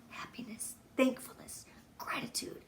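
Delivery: hushed tender whisper; whispered list. Real, not acted. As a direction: breathe the words out gently. Soft list of values.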